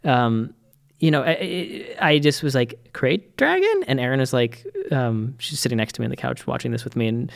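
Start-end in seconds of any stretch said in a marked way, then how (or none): 2.9-3.8 high incredulous "Krayt dragon?"; amazed, pitch shooting up on "Krayt dragon?"; surprised question. Retold or imitated.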